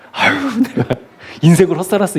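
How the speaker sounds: a high, hammed-up wail of regret; mock dismayed lament. Pastor mimics a rich brother's imagined regret, high mock wail. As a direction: play the brother who thinks he wasted his life.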